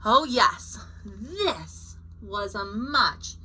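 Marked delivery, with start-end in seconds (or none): none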